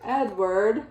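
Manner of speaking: plain reading voice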